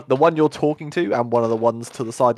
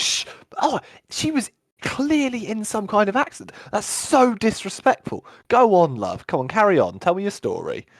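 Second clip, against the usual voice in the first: a gentle guard coaxing her to go on, up high; kindly guard; 10 semitones higher than usual. Acted. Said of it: "he turns around and goes".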